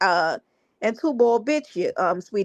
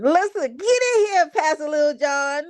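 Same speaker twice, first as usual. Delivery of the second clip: calling out; 5 semitones higher than usual. Real, not acted. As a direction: shout him into the chat. Calling Pastor Little John in.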